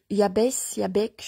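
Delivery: ordinary delivery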